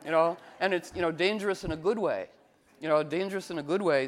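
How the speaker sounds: ordinary talk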